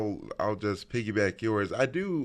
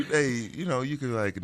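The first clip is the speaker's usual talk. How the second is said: usual speaking voice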